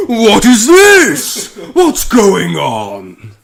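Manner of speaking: huge, slow, drawn-out bellow; very loud, stretched-out villain shout. Acted. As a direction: roar it out in hammed-up alarm.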